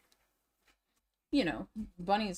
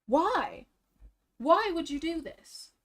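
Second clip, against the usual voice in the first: higher, pleading disbelief; exasperated at the cat; 6 semitones higher than usual. Retold or imitated. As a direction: scold the cat with mock despair. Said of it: "So I was like".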